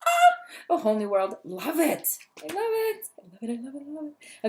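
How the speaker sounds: bubbling over with delight; real excitement. Real, not acted. Reader gushing over A Whole New World to her viewer, excited. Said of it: "I'm getting all excited".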